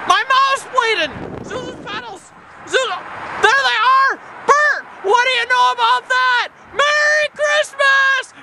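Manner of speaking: high, wild shouts of joy; crazed elation. Acted. George, overjoyed to be alive, shouts to Bert the policeman, crazed elation. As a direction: yell it like an overjoyed madman. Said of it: "running down the bridge like a crazy man".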